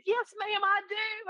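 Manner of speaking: very high-pitched imitated Southern reply; Southern good old boy. Client answers agent from Birmingham, high imitated Southern accent. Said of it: "good old boy kind of guy".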